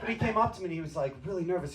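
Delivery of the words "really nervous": ordinary talk, nothing marked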